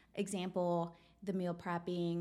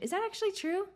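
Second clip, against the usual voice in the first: skeptical challenge; 10 semitones higher, faster than usual. Acted. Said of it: "Okay, challenge it".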